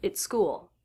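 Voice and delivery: "it's" rushed, "school" stressed and longer; quick at, long school. Shown as a demonstration. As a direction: tuck "it's" in, lean on "school". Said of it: "the word at much quicker than the word school".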